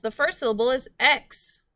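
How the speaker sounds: normal talking voice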